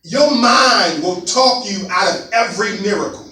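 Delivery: each word laid out slowly; slow it down so they catch it; said again slowly. Shown as a demonstration. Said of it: "Let me say that again slowly".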